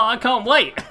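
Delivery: high, loud, put-on eagerness; mock excitement. Streamer jokes to viewers about a game years away, mock excited. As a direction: exclaim it with exaggerated anticipation. Feigned eagerness, then taken back.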